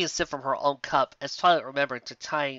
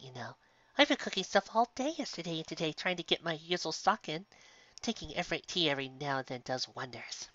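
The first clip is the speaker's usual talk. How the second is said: sweet baker mare; 3 semitones higher, 6 dB quieter than usual. Acted. Sweetie offers tea.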